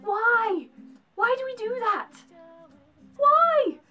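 very high, loud wail of disbelief; mock exasperation. Mock despair at British habit.

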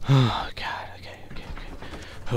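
breathless whisper to self; whisper, trying to calm down; frightened whisper. Real, not acted. Hiding, calming self.

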